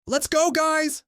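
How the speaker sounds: high, loud rallying yell. Acted. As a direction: call the troops to run.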